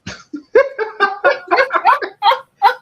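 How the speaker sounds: big howling cackle of a laugh, very high; loud mocking laugh. Real, not acted. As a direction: burst out in a loud scornful laugh. Mocking the other streamer.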